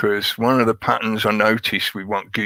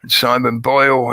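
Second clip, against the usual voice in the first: ordinary delivery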